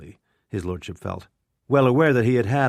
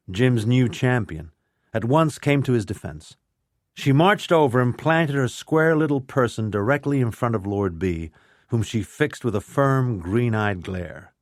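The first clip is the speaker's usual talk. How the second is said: usual speaking voice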